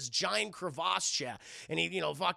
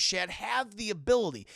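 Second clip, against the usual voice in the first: normal talking voice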